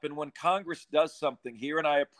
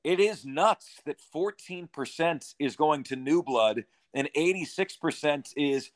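usual speaking voice

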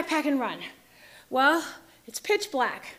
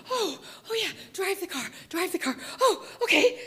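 flustered realisation; 4 semitones higher than usual. Retold or imitated. Echoing the order, catching on.

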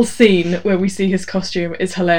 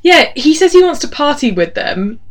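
usual speaking voice

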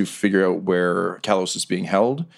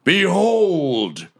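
high, very slow announcer reveal; ring announcer reveal; 6 semitones higher, much slower than usual. Acted. Riker presenting the challenger, slow grand reveal. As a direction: draw out the name for the big reveal. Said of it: "Riker gets back on the mic".

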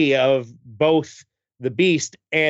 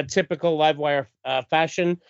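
ordinary talk

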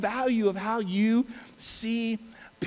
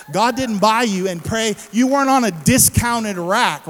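loud, raised preacher's proclamation; loud, emphatic sermon delivery; full-force preaching; 3 semitones higher, 8 dB louder than usual. Real, not acted.

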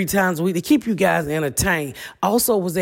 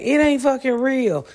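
mocking doubters; 7 semitones higher than usual. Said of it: "people can stop saying".

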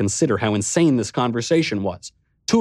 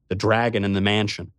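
ordinary delivery

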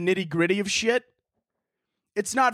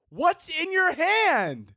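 "What's in your hand?" slow and pitched up in disbelief, stressing "hand"; higher, incredulous repeat; 9 semitones higher, much slower than usual. Acted.

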